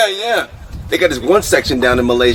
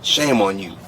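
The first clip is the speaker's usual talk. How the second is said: mock scolding; 7 semitones lower, 6 dB quieter than usual.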